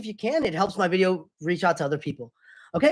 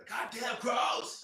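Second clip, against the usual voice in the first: drawn-out imitated yell; mock yell; 5 semitones higher, 13 dB quieter, much slower than usual. Roommate imitating the streamer yelling at crows, mock yell. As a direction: mimic someone yelling at birds. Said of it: "Did you hear me yelling?".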